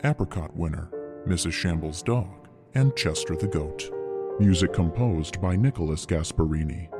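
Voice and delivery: ordinary delivery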